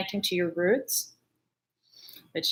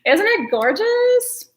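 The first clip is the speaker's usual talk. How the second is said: gushing; 12 semitones higher, 6 dB louder, much slower than usual. Real, not acted. Gushing after laughing at chat.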